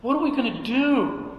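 high-pitched mock fretting, repeated; imitated worried back-and-forth. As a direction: mimic a group going in helpless circles. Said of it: "we went back and forth".

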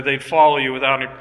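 ordinary talk, nothing marked

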